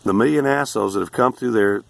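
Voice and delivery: normal talking voice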